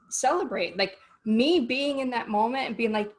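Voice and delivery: normal talking voice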